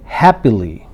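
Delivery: "happily" with HAP stressed, the rest dropping low; first-syllable stress. Shown as a demonstration. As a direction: drill "happily", weight on the first syllable, falling tune. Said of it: "put stress on the first syllable". ("happily" के पहले syllable पर ज़ोर)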